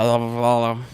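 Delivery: put-on slurred drunken babble; mock incoherent mumbling; drunk friend. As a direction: imitate someone too drunk to form words. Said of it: "he's just fucking gone".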